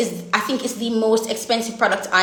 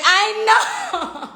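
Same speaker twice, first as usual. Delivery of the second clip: laughing; 11 semitones higher than usual. Real, not acted. Laughing at a chat comment.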